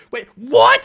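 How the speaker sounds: high, loud mock bewilderment; George. Commentator mimicking a friend's reaction, high loud imitation. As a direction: imitate a friend's startled yell. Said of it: "George is like".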